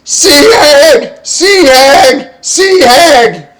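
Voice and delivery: high, very loud panicked scream; screaming alarm. Acted. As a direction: shriek the warning to the whole ship. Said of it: "start screaming".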